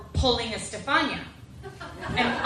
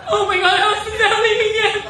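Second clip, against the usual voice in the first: high, loud, slow cry of mortified panic; hammed-up horrified yelling at herself; panicked shout; 9 semitones higher, 8 dB louder, slower than usual. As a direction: wail it like you've just ruined everything. Panicking after blurting too much.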